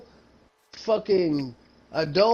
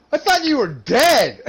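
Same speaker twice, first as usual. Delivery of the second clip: loud, higher, in disbelief; stunned disbelief; 5 semitones higher, 7 dB louder than usual. Retold or imitated. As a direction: say it like seeing a ghost. Shocked roommate at the bar.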